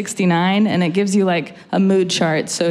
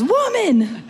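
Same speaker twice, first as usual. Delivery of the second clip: high, drawn-out mock call; imitated yelling; 10 semitones higher than usual. Security guard trying another word on the walker, mock yelling. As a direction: imitate a guard hailing someone, pitched up. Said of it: "they just start yelling at me".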